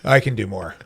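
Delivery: lightly voiced, low-pitched challenge; a hint of a defiant child, pitched low; imitating defiant daughter. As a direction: drop your voice to mimic a kid taking the dare. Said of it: "My daughter challenged it. She's like".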